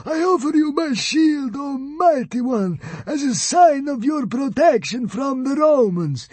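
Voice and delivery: high, wailing, grovelling plea; slow, high-pitched tearful surrender; sobbing. A narrator's reading. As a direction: wail it out, begging a god for mercy. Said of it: "he sobbed".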